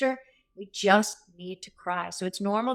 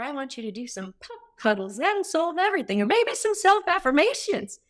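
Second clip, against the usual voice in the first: sing-song mockery, rising much higher; mocking sweet voice; 7 semitones higher than usual. Coach mocks useless advice to a stressed listener, sing-song and high. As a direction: poke fun at fluffy self-care advice, pitched up high.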